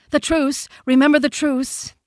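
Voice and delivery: high, loud, alarmed cry; high-pitched frightened herd voice; frightened deer. A narrator's reading. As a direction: call out the warning in a panic. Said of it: "a frightened whisper ran along the ranks".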